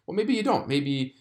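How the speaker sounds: ordinary delivery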